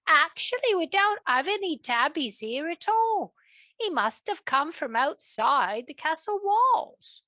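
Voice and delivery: lightly voiced kitchen helper; young helper voice. Agnes the helper speaks.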